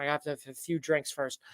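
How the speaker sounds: normal talking voice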